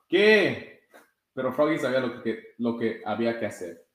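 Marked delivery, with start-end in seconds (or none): 0.1-0.7 loud high startled qué; startled answer. A narrator's reading. Froggy answers his dad, loud startled shout. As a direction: shout back, caught off guard.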